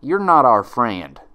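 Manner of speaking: loud slow scornful jab; scornful country mare, loud. Acted. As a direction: spit out her contempt. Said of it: "repeated Applejack scornfully".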